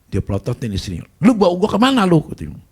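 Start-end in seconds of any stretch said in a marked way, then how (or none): 1.2-2.2 higher, louder snapping at her; angry scolding. Retold or imitated. Husband scolding his wife for bringing him, loud and high.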